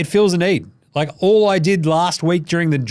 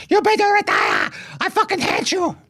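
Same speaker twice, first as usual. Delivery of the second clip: very high mock-female screech; hammed-up falsetto nagging wife; angry wife impression. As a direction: screech it in a badly faked French lady's voice. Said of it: "That's not a French lady's accent".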